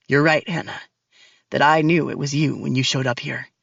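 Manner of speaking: low, cold male villain voice; deep and cold, taunting her; menacing man. Acted. Max's voice in story.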